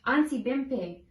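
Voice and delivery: ordinary delivery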